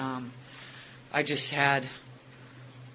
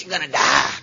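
a hint of a blunt psychic, louder; Louisiana psychic; 10 dB louder than usual. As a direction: deliver the doom like a fortune-teller, lightly voiced. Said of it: "one of those, you know, Louisiana psychics".

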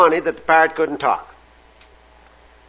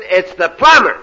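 loud, raised, slow spelling out; exasperated plumber; 8 semitones higher, 6 dB louder than usual. Acted. Plumber spelling out his trade to the parrot, exasperated call. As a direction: spell it out loudly, letter by letter. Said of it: "the voice came back and he said".

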